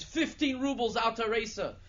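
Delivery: high, loud auction-style shout; screamed bid. Acted. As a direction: holler the bid over everyone. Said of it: "and he screams".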